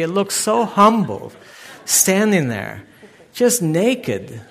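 high and loud, swept up in the story; excited retelling. Real, not acted. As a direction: tell it like you still can't believe it. Retelling the shocking pruning.

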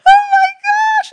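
very high, loud wailing cry; mock overwhelmed squeal. Girls overcome in the restaurant, hammed-up tearful squeal. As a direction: squeal it, imitating emotional girls.

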